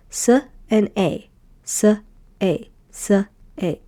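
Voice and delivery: teacher sounding out syllable. Shown as a demonstration. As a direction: blend S and A step by step. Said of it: "and you say".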